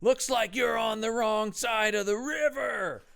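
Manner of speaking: high, slow mock yell, words stretched out; Brendan Fraser holler. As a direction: call it across a river, savouring the line. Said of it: "if I think of Brendan Fraser".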